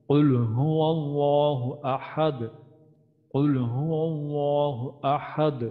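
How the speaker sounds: slow chanted recitation of the full verse; Quranic recitation. Shown as a demonstration. Teacher reciting the first verse for students. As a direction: intone the verse melodically.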